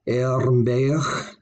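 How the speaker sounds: Ermberg ending in a throaty ch; final fricative g. Shown as a demonstration. Teacher demonstrating the word for arm ring, fricative at the end. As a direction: finish Ermberg on a rasped fricative. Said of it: "has a fricative sound".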